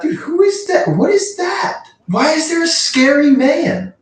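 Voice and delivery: high, panicked questions about the scary man; freaked out, voice jumping way up; scared horror game streamer. Real, not acted.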